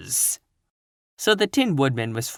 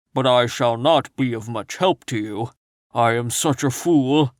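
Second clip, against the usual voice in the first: odd, self-mocking Scarecrow. A narrator's reading. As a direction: sigh at yourself as the Scarecrow. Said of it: "declared the Scarecrow".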